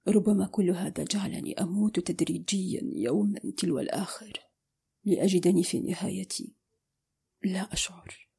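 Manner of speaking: strangely calm whisper. A narrator's reading. He confesses he feels nothing, fading whisper. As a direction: let it die away to a breath. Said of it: "وأكمل في هدوء غريب".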